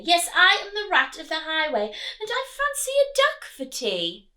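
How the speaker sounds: bully them like a greedy rat; big villainous rat; highway rat voice. Acted. Rat's boast repeated.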